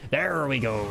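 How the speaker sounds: gruff pirate growl. Acted. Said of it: "in, like, a pirate voice".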